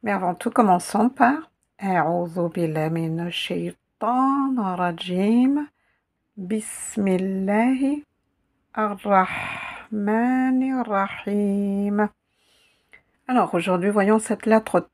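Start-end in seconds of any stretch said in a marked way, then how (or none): 1.8-12.1 measured recitation with lengthened vowels; Arabic recitation. Acted. Recites opening formula.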